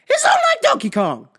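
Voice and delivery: high, loud battle cry; fired-up threat. Acted. Victim warns driver after getting his plate, fired-up shout.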